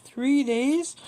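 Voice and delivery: high, loud, incredulous question; shocked. A narrator's reading. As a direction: blurt it out in shocked disbelief. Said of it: "father is shocked".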